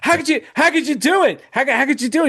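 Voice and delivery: high, incredulous mock outburst; imitating a friend's shocked protest; outraged Will Hunting. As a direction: squeak out the disbelief at the buddy. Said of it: "He's like".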